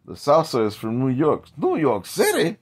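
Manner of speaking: high, loud hammed-up ad voice; mock commercial voice. Mocking the salsa commercial, high and loud. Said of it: "like that commercial where they have".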